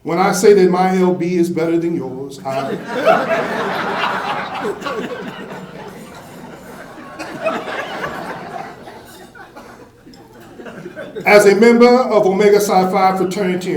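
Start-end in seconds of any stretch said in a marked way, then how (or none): 2.8-9.0 a long airy laugh at his own joke; long breathy laugh. Real, not acted. Speaker laughing at his own LB joke, airy laugh. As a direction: let a soft breathless laugh roll out.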